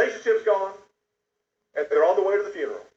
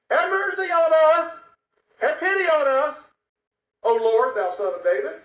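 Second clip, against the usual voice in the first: crying out for mercy; 11 semitones higher than usual. Acted. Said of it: "they cried out".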